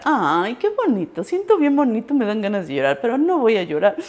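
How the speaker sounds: teacher choking up, joking it's the onion; teary teacher. Retold or imitated. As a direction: pretend it's only the onion. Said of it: "Dijo la maestra".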